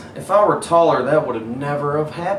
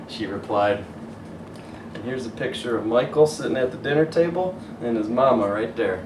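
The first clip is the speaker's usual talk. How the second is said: ordinary delivery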